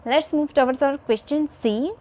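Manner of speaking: ordinary talk, nothing marked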